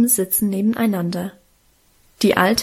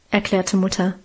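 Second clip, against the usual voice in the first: usual speaking voice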